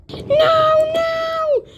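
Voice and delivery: high, terrified scream. Acted. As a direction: scream in terror. Character about to be squashed.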